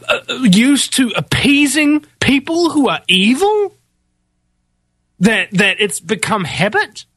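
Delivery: high-pitched, exasperated rant; impassioned, incredulous rant. Real, not acted. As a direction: let the pitch climb as the rant builds. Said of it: "I'm ranting".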